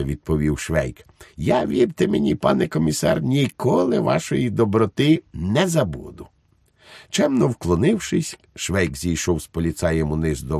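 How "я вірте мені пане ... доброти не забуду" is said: high-pitched, effusive thanks; grateful Švejk. A narrator's reading. Švejk thanks the police commissioner for his kindness, effusive. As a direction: pledge gratitude in a raised, guileless voice.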